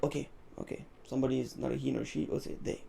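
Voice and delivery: hushed, half-whispered thinking aloud; inner thought. Retold or imitated. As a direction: murmur it to yourself, settling the rule. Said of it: "I was like".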